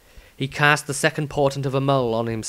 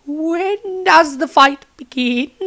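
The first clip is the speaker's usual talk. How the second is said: higher, slow nervous question; 5 dB louder, slower than usual. Acted. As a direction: ask it with shaky nerves. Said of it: "voice shook nervously".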